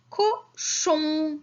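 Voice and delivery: "cochon" split into high "co" and long "chon"; teacher separating the syllables of "cochon" for children. Shown as a demonstration. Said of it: "la syllabe que j'entends".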